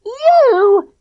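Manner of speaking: high, loud shriek; girl shrieking at the hero. A narrator's reading. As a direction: shriek it in delight. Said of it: "shrieked Clipper".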